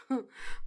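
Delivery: short, soft low chuckle; a quick husband's chuckle, acted; amused laugh. A narrator's reading. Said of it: "ضحك حسن على كلام آيات وبيقول".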